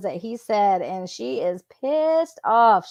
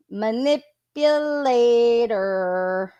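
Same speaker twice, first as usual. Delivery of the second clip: long drawn-out, sing-out label; mocking accusation; much slower than usual. Real, not acted. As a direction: stretch the word slowly to call her out. Accusing aside on the texts read.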